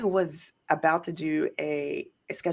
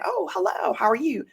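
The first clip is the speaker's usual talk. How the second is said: cheery greeting; 10 semitones higher than usual. Retold or imitated. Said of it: "I said".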